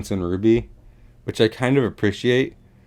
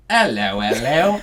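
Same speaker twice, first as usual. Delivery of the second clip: drawn-out cartoon English greeting; hammed-up, badly faked English accent; purposely bad English accent; 2 semitones higher, slower than usual. Two friends as old-time English folk, hammy English accent. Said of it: "that was a purposely bad accent".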